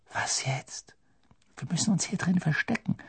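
soft, secretive near-whisper; hushed. A narrator's reading. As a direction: keep it down while hiding. Hiding after the escape.

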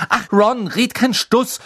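high, loud, impatient scolding; exasperated, biting dismissal; snapping at him. A narrator's reading. Hermine rounds on Ron over his idea, snapping. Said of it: "fuhr ihn Hermine an".

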